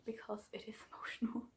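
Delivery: soft whispered aside with a laugh. Real, not acted. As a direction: whisper the warning with a little laugh. Warning about reading on transport.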